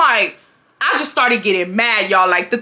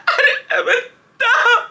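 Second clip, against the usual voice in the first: crying; 13 semitones higher than usual. Retold or imitated. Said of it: "I get the crying".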